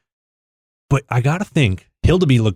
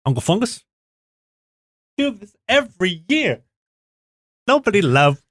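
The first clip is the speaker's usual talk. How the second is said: high-pitched cartoon sloth impression; Sid the Sloth; 11 semitones higher than usual. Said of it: "You do a good Sid impression".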